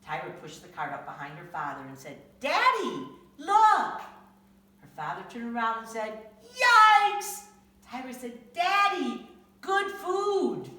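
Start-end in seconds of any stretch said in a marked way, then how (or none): 2.4-4.0 put-on high, loud, thrilled child; excited little girl. Tyra showing off her cart, high loud excited child. 6.4-7.4 high, loud startled yelp of a shocked dad. Acted. Father seeing the ice cream cart, loud startled yelp. 8.5-10.7 put-on high, loud sweet child voice; excited little girl. Tyra offering candy to her daddy, high childish glee.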